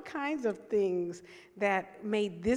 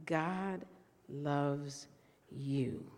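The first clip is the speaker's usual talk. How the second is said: tender declaration; 6 semitones lower, 4 dB quieter, much slower than usual. Real, not acted. Preacher repeats declaration softly.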